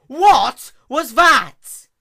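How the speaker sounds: shrieked high and drawn out; startled yell. A narrator's reading. Princess shrieks as a star explodes in her mane. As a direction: scream it in sudden alarm. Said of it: "she yelled".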